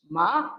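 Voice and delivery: MA starts low and climbs; rising tone. Shown as a demonstration. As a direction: glide MA upward. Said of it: "Ma with a rising pitch".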